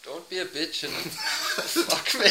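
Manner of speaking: slow mock Scottish, breaking into laughter; Scottish accent. Player inventing a crude saying, laughing Scottish accent. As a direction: crack up mid-line in a Scottish accent. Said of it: "What is something that Scottish people say?".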